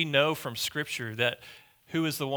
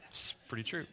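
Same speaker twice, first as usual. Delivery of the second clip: usual speaking voice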